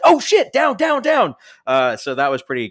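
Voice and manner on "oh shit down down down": very high, loud duck-for-cover yell; mock panicked shout. Everyone as Selena fires the gun, high mock-panicked shouting. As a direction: yell an urgent warning in exaggerated alarm. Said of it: "she fires and everyone's like".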